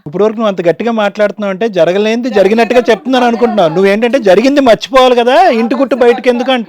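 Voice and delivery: loud, insistent challenge; heated argument. Real, not acted. Male host argues over the shouting. (గట్టిగా వాదిస్తూ)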